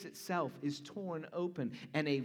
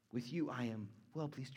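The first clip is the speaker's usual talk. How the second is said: much softer, gentle declaration; tender hush; 7 semitones lower, 10 dB quieter than usual. Acted. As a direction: say it softly, like a father's blessing. Softly voicing God's pleasure.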